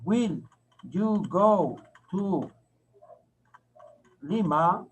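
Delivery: very slow, word by word; teacher's drawn-out writing-along question; slow dictation. Shown as a demonstration. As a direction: dictate the question with spaced words. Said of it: "voy a escribir en inglés".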